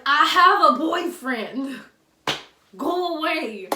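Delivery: loud, high, slow rebuff; loud telling off. Retold or imitated. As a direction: shout him away.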